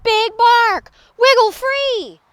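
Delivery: high, loud urgent call; shouting. A narrator's reading. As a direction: shout up to someone in danger. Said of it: "the prairie dog shouted".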